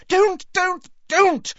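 high, loud panicked pleading; hysterical. Acted. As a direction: beg her to stop, panicking. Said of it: "flapping her hands hysterically".

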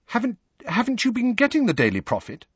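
high, hesitant, nervous question; nervously. A narrator's reading. Hermione asks Harry about the newspaper, nervously. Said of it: "Hermione asked nervously".

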